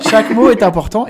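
« chaque mot est important » martelé, fort et plus haut; chaque mot appuyé. Shown as a demonstration. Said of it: "il faut prendre son temps". (« chaque mot » bien détaché, voix forte et montée)

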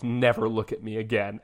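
low, quiet imitation of a threat; mock menacing. Retelling Santa's warning to Kurt, quietly menacing. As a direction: drop the voice low and soft, mock-ominous.

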